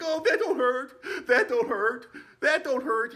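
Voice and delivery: high, loud, laughing squeal; pained squeal. Retold or imitated. Zed squealing about the pain after the fall.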